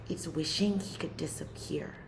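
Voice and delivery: soft unvoiced whisper. Teacher whispering to students about silence, hushed. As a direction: whisper it as if disappearing.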